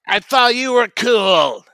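sudden hammed-up shout; mock outburst. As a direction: yell it at him in fake betrayal.

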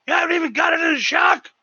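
furious high shout, then growled rah rah rah; angry yelling boss. Retold or imitated. Boss screaming at cook over the shock, furious shout. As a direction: bellow like a chef losing it. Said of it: "He's like".

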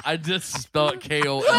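normal talking voice

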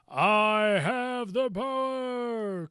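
big slow triumphant cry; heroic shout. Retold or imitated. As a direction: proclaim it like a hero. Quoting He-Man's catchphrase.

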